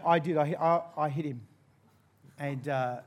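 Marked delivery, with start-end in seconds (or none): none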